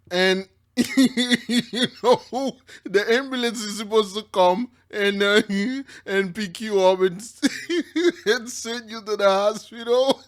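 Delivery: can barely get it out for laughing; laughing through words. Real, not acted. Retelling the ambulance crash to viewers, laughing.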